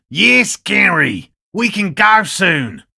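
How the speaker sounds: slow, loud, high shouted reply; slow hollered answer to a companion; shouting to Gary. Acted. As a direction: call back to Gary from a distance, drawn out. Calling out to Gary.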